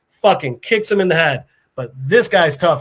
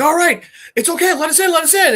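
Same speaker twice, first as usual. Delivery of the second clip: high-pitched, hurried mock plea; urgent pleading; 11 semitones higher, faster than usual. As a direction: act out begging at the door. [name] pleads to be let in.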